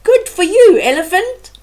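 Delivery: high squeaky spider calling out; called out. A narrator's reading. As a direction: call it from up in the tree, high and loud. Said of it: "Ananzi called from the bananas".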